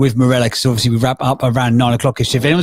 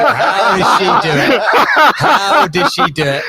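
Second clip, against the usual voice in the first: slow, high, laughing tease; drawn-out tease through laughter; laughing teasing; 7 semitones higher, slower than usual. Real, not acted. Host teasing the guest while laughing.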